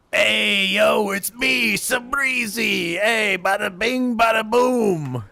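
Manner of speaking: loud, high-pitched mock impression, drawn out; Sabrizi. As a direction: belt out a hammed-up, high caricature voice. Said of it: "here's my Sabrizi impression".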